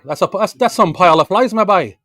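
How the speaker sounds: high, loud mock Gaspé accent. Repeating the Gaspé saying for a puzzled friend, Gaspé accent. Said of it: "they speak fast and, like".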